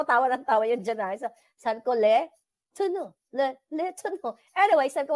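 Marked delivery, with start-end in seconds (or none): 1.9-4.3 Le Tourneau with a high, lilting French NO; hammed-up French Le, Le, Tourneau; copying the seller's fancy French; mock French pronunciation. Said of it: "Tapos ginagaya ko".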